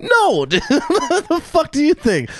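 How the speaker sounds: high, squealing protest breaking into laughter; hammed-up incredulity, voice shooting way up; mock outraged refusal. Client turning down barber's massage offer, mock outraged squeal.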